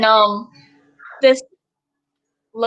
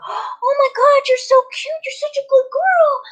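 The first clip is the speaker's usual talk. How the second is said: mocking a stranger who gushes over the service dog; gushing dog lady; 14 semitones higher than usual. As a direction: coo at the puppy like an overexcited stranger. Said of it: "she's like this".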